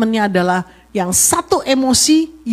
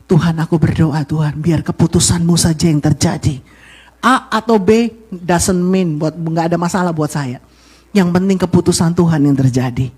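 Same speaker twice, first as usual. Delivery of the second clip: low, even prayer of surrender; doa istri pasrah; 6 semitones lower than usual. Acted. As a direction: pray submissively as the wife. (berdoa pasrah dengan nada rendah)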